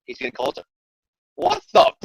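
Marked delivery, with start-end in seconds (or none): 1.3-1.9 high startled yelp. Retold or imitated. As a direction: jump at the noise. Harry startled by intruder.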